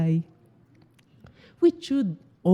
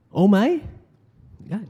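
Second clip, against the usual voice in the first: surprised; 5 semitones higher than usual. Shown as a demonstration. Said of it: "if they're surprised".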